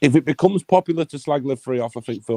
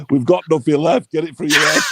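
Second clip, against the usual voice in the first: a touch higher and louder, hammed-up telling off; mock Live Free scolding; 4 semitones higher, 4 dB louder than usual. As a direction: play the exasperated partner in jest. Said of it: "give him a good slap and go".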